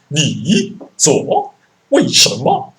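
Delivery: slow, high sing-song mock Chinese; fake Chinese. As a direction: drag the tones up and down, mock Mandarin. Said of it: "make it sound like you're speaking Chinese".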